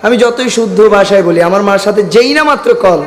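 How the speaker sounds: usual speaking voice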